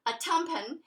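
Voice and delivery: plain reading voice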